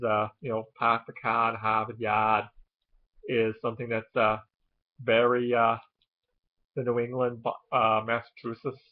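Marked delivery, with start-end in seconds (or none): 0.8-2.5 dropped R's, Boston style; Boston accent. Shown as a demonstration. Vlogger giving the well-known sample of a Boston accent. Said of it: "the Boston accent is".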